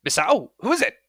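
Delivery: high, loud call through the house; voice from inside. Acted. Parent calls from inside to the door, inquiring. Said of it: "you hear a voice from inside go".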